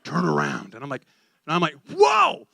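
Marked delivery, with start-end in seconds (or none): 0.0-0.8 very low, full dove voice; big, deep voice. Retold or imitated. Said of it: "A big, deep voice". 1.9-2.5 high, loud whoa of alarm; startled whoa. Retold or imitated. Acts startled cry at the edge.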